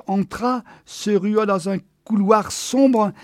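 plain reading voice

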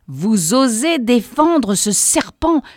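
shouting in her face. A narrator's reading. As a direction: yell it right into her face. Boss yelling again.

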